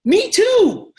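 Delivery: high and loud, all in; the excited answer to any reply; enthusiastic agreement. Acted. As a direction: beam it back at them. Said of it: "Here's my answer".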